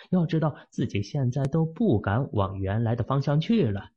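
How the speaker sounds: usual speaking voice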